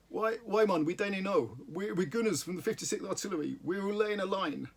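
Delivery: broad Geordie, slowing at the end; thick Geordie accent. Acted. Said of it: "in a thick Geordie accent".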